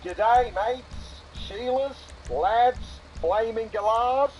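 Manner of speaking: hammed-up Aussie roll call, slow and high; mock Australian accent. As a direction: play a cartoon Australian host, drawn out. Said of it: "the wheels have come off the accent".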